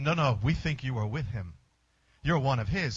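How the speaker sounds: low, soft insistence; quiet, knowing accusation; lightly voiced accusers; insisting bystanders.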